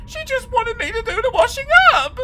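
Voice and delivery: put-on high, loud panicked girl; frantic protest. A narrator's reading. Helen pleading with the skeleton, high and frantic. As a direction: plead desperately for her mother.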